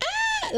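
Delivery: a very high, thin mock shriek; imitated child shriek. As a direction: squeak like a kid who's spotted a bug. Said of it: "she'd be like".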